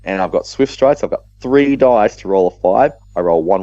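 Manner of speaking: normal talking voice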